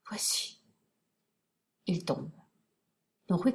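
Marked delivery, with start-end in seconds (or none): 0.1-0.6 last breath whispered out; with a sigh. Acted. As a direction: sigh the word, barely voiced. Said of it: "avec un soupir".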